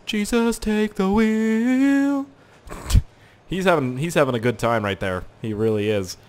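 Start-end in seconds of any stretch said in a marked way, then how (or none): 0.1-2.3 very slow, higher "take the wheel"; drawn-out plea. Real, not acted. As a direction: stretch it out like a prayer.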